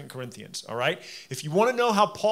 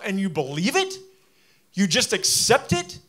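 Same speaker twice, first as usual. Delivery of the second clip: incredulous; 4 semitones higher, 4 dB louder than usual. Retold or imitated. Paul's incredulous rebuke voiced.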